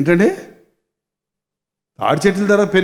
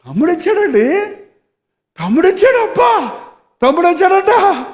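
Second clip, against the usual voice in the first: excited; 9 semitones higher than usual. Acted. Preacher acting the joy the elder son should feel. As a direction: shout the good news happily.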